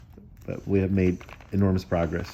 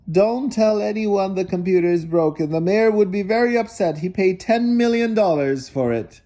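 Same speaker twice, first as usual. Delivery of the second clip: yelling after; 9 semitones higher than usual. A narrator's reading. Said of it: "the old man yelled after him".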